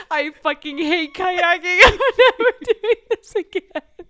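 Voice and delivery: loud, high outburst breaking into laughter; hammed-up exasperated yelling; mock furious rant. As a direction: imitate a fed-up tantrum while cracking up. Sister's kayaking rant retold.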